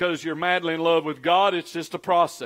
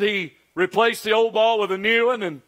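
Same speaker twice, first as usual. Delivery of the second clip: normal talking voice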